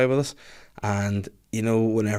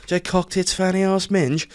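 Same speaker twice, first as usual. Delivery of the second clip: higher, recited like a memorized list; imitating the rep; 8 semitones higher than usual. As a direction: reel it off word by word like a script. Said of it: "he had memorized".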